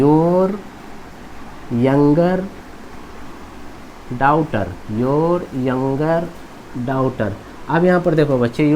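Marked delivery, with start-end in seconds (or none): 0.0-4.6 word by word, long pauses between words; slow dictation. Shown as a demonstration. Teacher slowly spelling out the translation. 5.0-7.3 drawn-out words with a pause before the last; slow dictation. Shown as a demonstration. Teacher repeating translation word by word.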